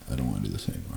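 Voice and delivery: weary inner voice. Retold or imitated.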